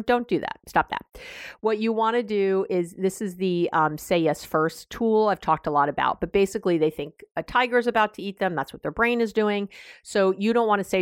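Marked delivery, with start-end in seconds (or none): none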